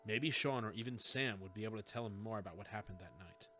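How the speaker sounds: usual speaking voice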